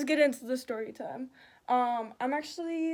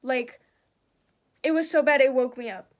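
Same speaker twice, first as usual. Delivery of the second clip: usual speaking voice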